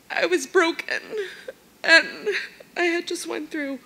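high voice, pushing on through tears; choked up but determined to say it; tearful. Real, not acted. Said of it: "I knew I was gonna cry".

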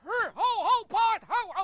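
loud, high shouts to a horse; calling whoa. Acted. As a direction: holler to halt a bolting horse. Knight halts a runaway horse.

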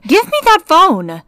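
demanding. Acted. Mom grabs phone.

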